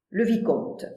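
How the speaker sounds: plain reading voice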